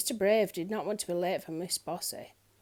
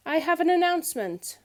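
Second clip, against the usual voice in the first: higher, louder proclamation; announcing; 8 semitones higher, 6 dB louder than usual. A narrator's reading. Miss Trouble addressing the gathered crowd, proclaiming loudly. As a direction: make it an announcement. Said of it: "announced little Miss Trouble".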